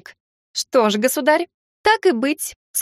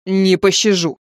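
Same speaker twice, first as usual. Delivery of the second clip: booming thunder and wind; 3 semitones lower, 3 dB louder, slower than usual. Acted. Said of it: "прогремел голос царя".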